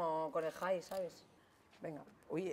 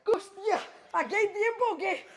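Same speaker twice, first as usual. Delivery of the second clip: startled shout; 11 semitones higher, 8 dB louder, slower than usual. Real, not acted. Panicking on the climb.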